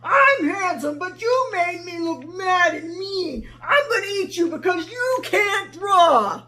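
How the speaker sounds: loud, high, vain and furious lion; cranky lion threatening the girl; angry lion. Acted. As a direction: bellow it like a proud cranky lion.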